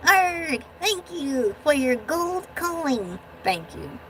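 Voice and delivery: slow growly pirate voice; little pirate guy. Pretend. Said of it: "this little guy can do that better".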